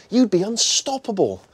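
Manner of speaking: ordinary delivery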